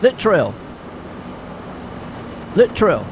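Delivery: "Littrell" run fast as LIT-ril; quick Littrell. Shown as a demonstration. Commentator practising a driver's name quickly. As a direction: clip "Littrell" short and fast. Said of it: "is that fast enough?".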